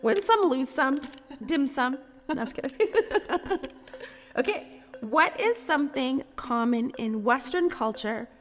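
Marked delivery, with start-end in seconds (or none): none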